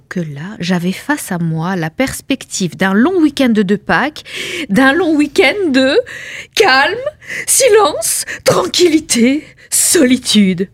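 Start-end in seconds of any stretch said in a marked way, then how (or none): 4.7-10.7 higher, slow, each word melting; rapturous savouring. Acted. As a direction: swoon over calm and silence. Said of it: "dreamy list repeated".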